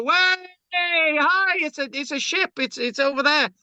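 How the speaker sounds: high, excited mock spectator; flag-waving sightseer. Lecturer mimics flag-wavers spotting the ship, high and giddy. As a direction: cheer like a flag-waving onlooker. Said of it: "You know".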